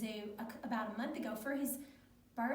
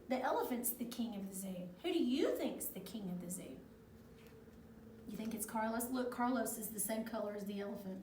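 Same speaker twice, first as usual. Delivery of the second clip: plain reading voice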